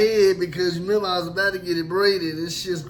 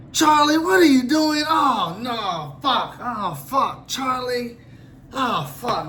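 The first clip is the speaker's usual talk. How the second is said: disgusted guard; 5 semitones higher, slower than usual. Retold or imitated. Guard discovering the mess in Charlie's cell, yelling. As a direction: cry out at the horrible smell.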